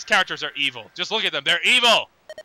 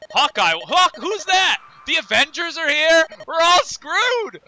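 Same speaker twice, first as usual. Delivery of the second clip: much higher, louder hammed-up panic; mock panic; 10 semitones higher, 4 dB louder, slower than usual. Old man spotting the heroes, hammed-up shrieking panic. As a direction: shriek like a cartoon coward.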